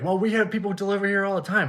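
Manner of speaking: high-pitched imitation of a customer; protesting customer. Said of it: "And he's like".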